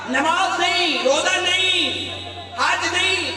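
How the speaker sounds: each نہیں shouted high and falling; the preacher shouts the list to the mourners; shouted climax. Acted. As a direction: hammer every نہیں down hard.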